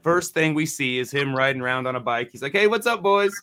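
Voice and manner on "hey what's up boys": higher friendly greeting, mildly hammed; mock cheery cop. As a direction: greet the boys like a chummy cop. Cop greeting them at concert.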